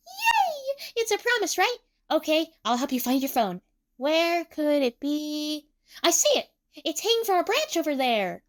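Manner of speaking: high, bubbly delight; perky helper, singing out as she searches; Emu. A narrator's reading. Emu helps find the phone, high and cheerful.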